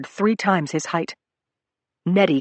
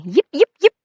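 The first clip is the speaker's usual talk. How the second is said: high, loud squeaking yips; mouse squeaks; 11 semitones higher, 7 dB louder than usual. Acted. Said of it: "Fred Mouse was squeaking".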